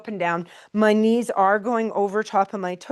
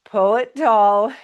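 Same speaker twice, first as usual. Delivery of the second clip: slow, louder cue that the husband copies; louder, drawn-out cue; 7 dB louder, slower than usual. Real, not acted. Instructor calling the move to her class, louder and slow. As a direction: stretch the words out, louder. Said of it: "he copied me with the woo".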